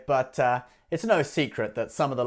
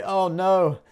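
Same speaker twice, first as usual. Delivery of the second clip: higher, hammed-up pity for the banks; mock sob story; 9 semitones higher, 4 dB louder than usual. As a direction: fake sorrow, laying it on thick. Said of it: "the whole sob story".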